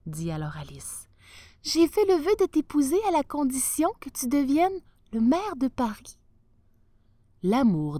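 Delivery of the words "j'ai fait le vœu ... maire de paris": higher, lightly voiced girl; a young woman holding to her vow; a hint of a girlish voice, higher; young bride's voice. Alice stating her vow.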